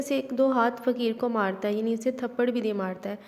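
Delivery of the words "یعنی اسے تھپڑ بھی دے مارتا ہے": ordinary delivery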